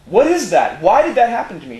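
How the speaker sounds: high, loud, rattled questions; panicked questions. Retold or imitated. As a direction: demand answers, shaken up. Shaken man questioning his wife.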